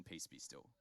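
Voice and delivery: a soft, deep voice stilling the storm; hushed calm command. Acted. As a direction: breathe it like a gentle command. Jesus speaks into your situation.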